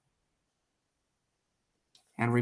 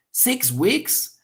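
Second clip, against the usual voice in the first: SIX WEEKS pitched high, swooping up; emphatic disbelief; 8 semitones higher than usual. Shown as a demonstration. Second speaker repeating the news in disbelief, emphatic. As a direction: echo SIX WEEKS as an incredulous question. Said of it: "So he's doing emphasis".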